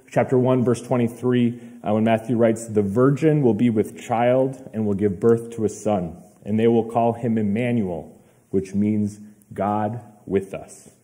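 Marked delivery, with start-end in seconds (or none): none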